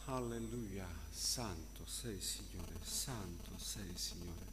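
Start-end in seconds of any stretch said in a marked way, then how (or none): none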